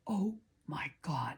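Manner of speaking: hushed unvoiced gasp of fear; whispered dread. Rider seeing low oak branches ahead, terrified whisper. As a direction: breathe it out as the branches loom. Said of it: "and I'm like".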